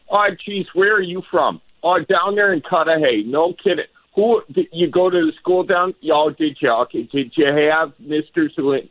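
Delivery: high-pitched Wisconsin small talk; nosy neighborly Wisconsin accent. Acted. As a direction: play a chatty Wisconsin local, voice lifted.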